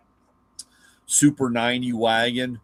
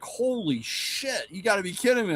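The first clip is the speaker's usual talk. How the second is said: stunned disbelief; 8 semitones higher, 3 dB quieter than usual. Retold or imitated. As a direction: gasp it out like you can't believe it. Dad stunned at auction.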